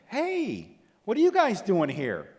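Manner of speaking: high, loud, breezy hello to latecomers; overly friendly greeting. Retold or imitated. Dealership friend greets the couple, acting overly friendly. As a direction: play the cheery salesman who forgot the meeting. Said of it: "comes up to us real friendly".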